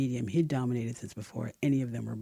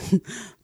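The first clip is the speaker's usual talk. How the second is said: high bright giggle; a short girlish giggle; 8 semitones higher, 6 dB louder than usual. A narrator's reading. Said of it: "she added with a giggle".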